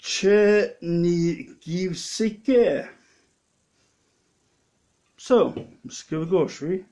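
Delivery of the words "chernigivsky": "Chernigivsky" sounded out slowly, syllable by syllable; slow, careful "Chernigivsky" as a first try at a foreign word; careful pronunciation. Shown as a demonstration. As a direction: read "Chernigivsky" off the label slowly and deliberately. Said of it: "it is pronounced".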